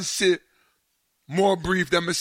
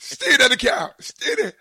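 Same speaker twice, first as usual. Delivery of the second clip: loud urgent order; 8 semitones higher, 7 dB louder than usual. Retold or imitated. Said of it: "my brother-in-law".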